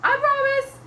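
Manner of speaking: high, loud horse voice; promising horse. Acted. Horse answers Mister Gumpy, high loud promise. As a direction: promise loudly in a whinnying pitch. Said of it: "Horse said".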